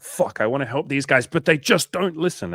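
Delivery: high, loud exasperated complaint; frustrated helper. Retold or imitated. Burnt-out helper venting about stubborn people, in a high loud exasperated voice. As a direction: vent about people not listening. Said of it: "The feeling of, like".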